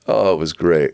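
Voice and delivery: ordinary delivery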